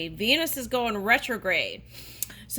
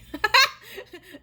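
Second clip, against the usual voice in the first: loud amused laugh; short laugh; 13 semitones higher, 8 dB louder than usual. Real, not acted. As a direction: laugh at the line. Laughs at own reading.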